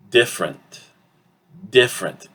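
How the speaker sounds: "different" in two syllables, DIF-rent; reduced pronunciation. Shown as a demonstration. Teacher shows the result of reduction, "different" as DIF-rent. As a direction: drop the middle vowel of "different": DIF-rent. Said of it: "we get something like this".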